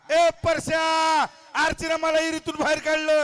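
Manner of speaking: high, forceful holler; loud shout. Shown as a demonstration. Host demonstrates again for the struggling child, shouting. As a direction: belt out the line at a high pitch. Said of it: "मोठ्याने ओरडून बोलायचंय".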